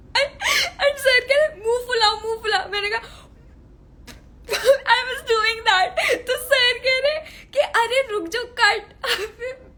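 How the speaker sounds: can't stop laughing while retelling; laughing through it. Real, not acted. Telling fans about the director on her first scene, laughing hard.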